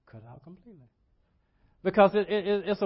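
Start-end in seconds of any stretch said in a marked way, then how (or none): 0.1-0.9 "Cut it out completely" said much softer, nearly under the breath; the repeat dropped to a near hush; hushed echo. Real, not acted. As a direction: let "completely" fade to a murmur. Echoes it, almost under breath.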